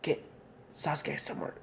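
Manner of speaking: last words as he passes out; fading weakly. Acted. Naruto telling Sakura to protect Sasuke as he faints, fading to a breath. Hero fading before passing out.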